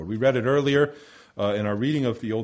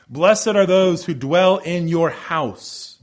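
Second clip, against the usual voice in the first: scripture reading; 2 semitones higher, 5 dB louder, slower than usual. Acted. Quotes Psalm eighty-four.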